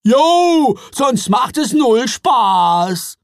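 put-on loud, high troll boys calling. A narrator's reading. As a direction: shout it out like cheeky troll boys. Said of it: "riefen ihre Söhne".

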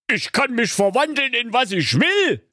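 very high boastful sorcerer cry; gloating wizard. Acted. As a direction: crow it out with a sneer. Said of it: "lachte hämisch und rief". (hämisch rufender Zauberer)

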